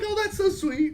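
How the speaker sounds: a lightly voiced, high-pitched aunt; high aunt quote. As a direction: voice jumps up high, sweetly delighted. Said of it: "oh, she's like".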